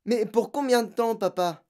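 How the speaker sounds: put-on dismayed girl asking; anxious pleading. A narrator's reading. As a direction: press your father for an answer. Girl anxiously questions shouting father.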